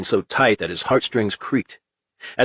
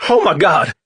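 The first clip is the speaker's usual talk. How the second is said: loud alarmed yell of "Oh my God!"; 6 semitones higher, 5 dB louder than usual. A narrator's reading. Delivery guy yelling in shock at the crash. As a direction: cry out high and loud in shock.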